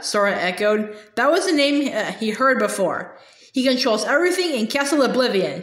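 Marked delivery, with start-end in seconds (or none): none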